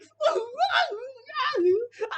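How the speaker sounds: long, loud, high fake wail; mock bawling. Commentator making fun of a streamer's breakdown, bawling fake cry. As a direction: bawl like an exaggerated crybaby. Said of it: "making fun of his mental breakdown".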